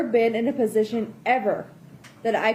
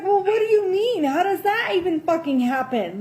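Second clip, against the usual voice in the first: incredulous; 6 semitones higher than usual. Retold or imitated. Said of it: "I was like".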